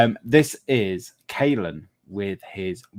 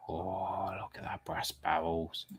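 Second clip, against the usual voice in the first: hushed admiration; 10 dB quieter than usual. Real, not acted. Viewer drooling over a friend's model ship, hushed admiration. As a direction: marvel quietly under your breath.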